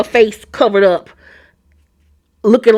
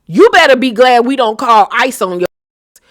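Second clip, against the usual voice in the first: usual speaking voice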